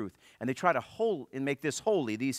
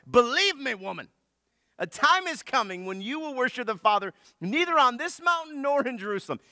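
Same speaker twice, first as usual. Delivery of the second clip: louder, higher Southern preacher cadence; 11 semitones higher, 5 dB louder than usual. Retold or imitated. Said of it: "somewhere down south".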